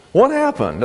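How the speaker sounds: high, sudden, amazed; jolted out of himself by the change; startled question. Acted. George amazed the snow has stopped, high and startled.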